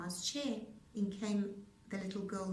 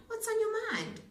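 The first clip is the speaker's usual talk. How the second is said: high voices; 12 semitones higher, 5 dB louder than usual. A narrator's reading. Dialogue on phone.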